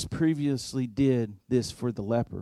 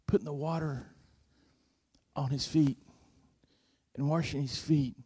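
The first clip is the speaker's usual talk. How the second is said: softer, intimate retelling; hushed tender recollection; 5 dB quieter than usual. Real, not acted. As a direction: drop your voice, reverent. Tender memory of washing feet.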